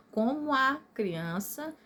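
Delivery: COMO A glided into co-mua before CRIANÇA; slow COMO A CRIANÇA, with COMO A as one word; vowels run together. Shown as a demonstration. Teacher showing poetic syllables, COMO A joined in the verse. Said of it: "é como se fosse uma palavra só".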